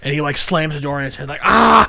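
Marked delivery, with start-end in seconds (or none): 1.4-1.9 sharp high yelp of pain; mock pain yelp. Friend retelling the movie gag, mock pain yelp. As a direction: yelp like a door hit your hand.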